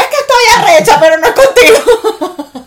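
high, loud mock yell of an upset mother. Mom yelling at her daughter about the boss, loud and high. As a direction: shout it like a fed-up mom. Mother admits she is furious.

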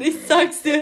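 loud. Pretend. Joking about bad Bavarian.

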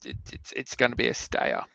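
ordinary talk

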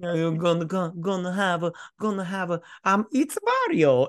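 put-on groping for a Mario-style Italian accent; attempted Italian accent. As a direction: try out a cartoonish Italian accent, haltingly. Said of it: "Think of one of your favorite Italian movies".

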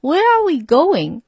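curious passenger asking the bus driver; puzzled passenger. A narrator's reading. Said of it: "said the man in the red tie".

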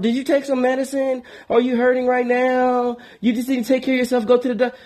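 high, insistent caring woman; worried woman friend. Retold or imitated. Female friend on the phone insisting he see a doctor, high and fussing. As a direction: fuss over him like a worried girlfriend.

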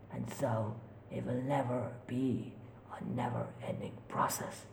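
soft, creepy taunting voice; ghostly whisper. Acted. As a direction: needle him softly.

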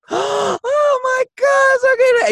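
very high, slow gasping shock; shocked mom. Retold or imitated. Son retells mom seeing the pregnant friend, high and stunned. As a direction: gasp it out, stunned then forgiving. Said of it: "and then she was like".

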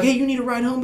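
high-pitched helpful cop offering a lift; friendly cop. Acted. Officer offering a couple a ride, friendly cop. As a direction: play a kindly officer, voice lifted high. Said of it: "they should be like".

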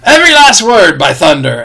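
a pirate wheedling the captain for the chart; Long John Silver. Acted.

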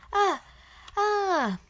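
high, knowing "ah". A narrator's reading. Goblin sentry reacts to the visitors, knowing. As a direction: let the ah show you understand. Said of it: "d'un air entendu".

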